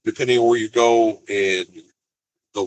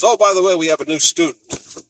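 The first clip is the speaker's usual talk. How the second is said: higher, louder imitated teacher; teacher's announcement; 7 semitones higher, 3 dB louder than usual. Teacher tells the class about a new student, imitated announcement. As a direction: voice a teacher's offhand announcement, pitched up.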